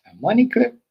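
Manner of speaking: high, loud disbelieving squeak; incredulous. Real, not acted. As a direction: sound stunned and amused. Disbelief at the money clip.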